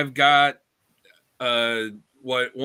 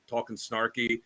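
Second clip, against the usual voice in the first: normal talking voice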